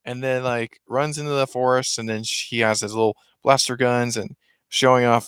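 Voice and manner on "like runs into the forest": ordinary delivery, nothing marked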